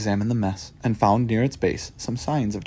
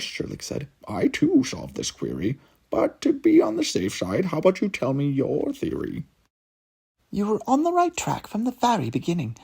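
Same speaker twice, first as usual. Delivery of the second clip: high, eager sidekick voice; a proud little cat laying out the clues; Watson's voice; 9 semitones higher than usual. Acted. Watson explains the case to Sherlock, high.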